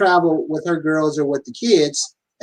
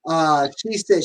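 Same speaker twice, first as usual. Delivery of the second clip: ordinary delivery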